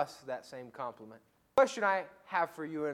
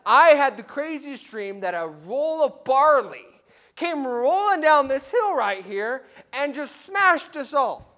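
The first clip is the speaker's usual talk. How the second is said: surfer-dude Midianite; 7 semitones higher, 10 dB louder than usual. Acted. Midianite soldier telling a buddy his nightmare, loud and excited. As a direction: spill the crazy dream breathlessly. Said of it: "the Midianite's like".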